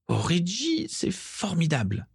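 high, soft, drawn-out rapture; tender, marvelling sigh of joy; rapt delight. A narrator's reading. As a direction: breathe it out slowly, entranced. Bellatrix rapt at receiving mark together.

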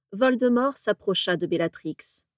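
plain reading voice

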